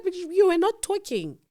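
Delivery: high, loud insistent retort; retold spat, voice pitched right up; heated argument. Couple bickering over a dropped phone call, heated retort. Acted quarrel with husband.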